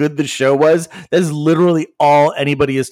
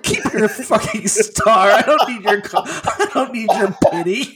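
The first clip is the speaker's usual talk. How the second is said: laughing mock retort; 6 semitones higher than usual. Retold angry retort with laughter.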